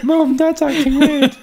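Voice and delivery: put-on high, laughing child calling mum; a giggling kid telling on dad.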